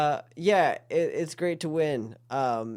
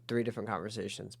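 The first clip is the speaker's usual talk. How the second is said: ordinary talk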